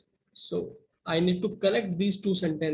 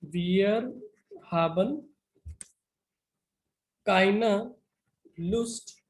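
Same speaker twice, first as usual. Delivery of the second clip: word-by-word dictation; much slower than usual. Shown as a demonstration. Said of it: "the final answer would be".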